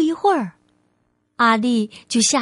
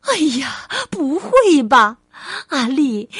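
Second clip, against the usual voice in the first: mother crying out in disbelief; shocked mum's outcry; dismayed exclamation. Acted. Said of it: "妈妈惊呼起来".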